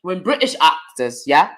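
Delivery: put-on London accent; London English, as a demonstration. Shown as a demonstration. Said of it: "trying to do my London accent".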